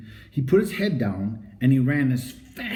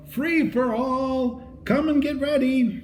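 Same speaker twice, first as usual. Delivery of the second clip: high, slow announcer call; 11 semitones higher, slower than usual. A narrator's reading. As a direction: call it out across the field. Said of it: "Then the man called".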